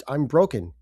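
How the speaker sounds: ordinary talk, nothing marked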